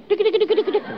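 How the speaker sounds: high, light "idik, idik" for dainty steps; mimicked footsteps. Preacher imitating a woman walking in his dream.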